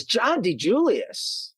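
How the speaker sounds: slow, high questioning of a name; surprised recognition. Retold or imitated. Restaurant acquaintance recognizing an author's name, retold with surprise. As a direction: repeat the name in drawn-out surprise.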